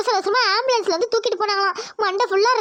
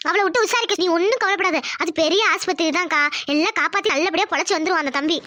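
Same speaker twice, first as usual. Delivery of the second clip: ordinary delivery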